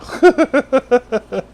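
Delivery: high, rapid chuckle; overcome laugh. Real, not acted. Preacher overcome mid-sermon, breathless laugh. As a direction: laugh while catching your breath.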